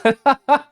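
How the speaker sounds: high, loud burst of laughter; gleeful laugh. Real, not acted. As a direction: laugh out with glee. Laughing at own build.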